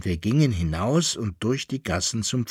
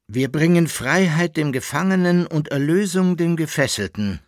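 high, exalted announcement; proclaiming call; 7 semitones higher, 4 dB louder than usual. A narrator's reading. Reb Amschel brings release to the prisoner, proclaiming call. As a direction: call out the good news solemnly. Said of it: "rief Reb Amschel".

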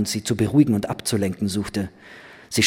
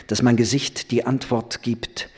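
plain reading voice

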